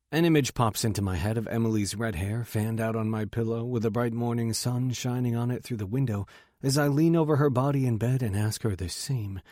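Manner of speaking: plain reading voice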